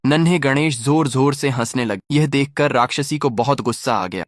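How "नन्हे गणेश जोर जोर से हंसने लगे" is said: ordinary delivery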